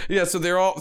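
ordinary talk, nothing marked

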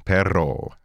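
Perro with a trilled double r; Perro, roll the rr clearly. Shown as a demonstration. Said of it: "Can you roll your R's?".

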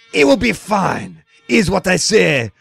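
slow, hammed-up French accent; mock French accent. Fake Lacroix reassures the interviewer about McGovern, in a French accent. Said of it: "god-awful French accent".

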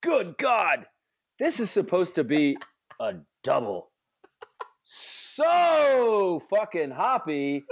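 high, disgusted diva whine. Acted. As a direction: read it like an outraged diva. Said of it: "do it in a diva voice".

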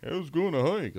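high mocking imitation of a hiker; mocking silly. Sceptic recalls how silly hiking seemed, mock high voice. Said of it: "hiking was kinda silly".

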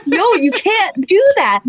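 high, drawn-out mock objection; scandalized protest. Objecting to auntie's cheating advice, scandalized. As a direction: imitate exasperation, stretching the words.

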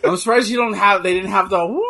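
ordinary delivery

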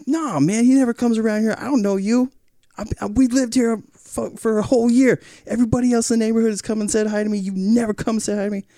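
high, insistent imitation of an indignant man; aggrieved neighbor. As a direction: argue back as the offended neighbor. Retold quarrel, neighbor's side.

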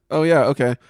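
high, loud, easy agreement; put-on voice of an obliging friend; mock agreeable friend. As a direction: pipe up in a high voice. Acted reply to the request.

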